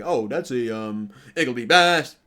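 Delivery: loud hammed-up rival fan; loud mock of a gloating Bills fan. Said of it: "I'm seeing Bills fan today".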